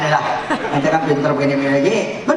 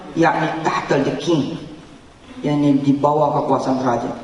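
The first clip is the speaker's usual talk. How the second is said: ordinary talk, nothing marked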